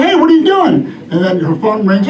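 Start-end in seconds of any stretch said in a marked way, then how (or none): 0.0-0.9 high startled call; surprised. Retold or imitated. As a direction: catch her in the act. Shouting at housekeeper in recliner.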